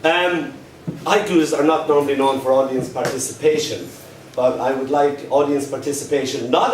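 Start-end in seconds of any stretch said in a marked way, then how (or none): none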